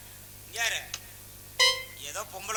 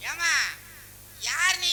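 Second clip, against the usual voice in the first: put-on louder, higher call to a deaf girl; shouting to be heard; 6 semitones higher, 6 dB louder, much slower than usual. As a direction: shout it so a deaf ear catches it.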